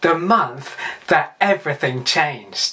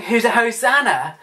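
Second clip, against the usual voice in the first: raised-pitch mock cheer; lightly called out, like a crowd's hail; imitated crowd shouting; 5 semitones higher than usual. Preacher recalls the crowds welcoming Jesus, mild mock shout. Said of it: "The crowds went from shouting".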